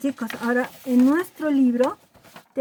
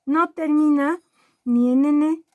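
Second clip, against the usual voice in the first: ordinary talk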